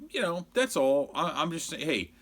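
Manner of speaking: ordinary talk, nothing marked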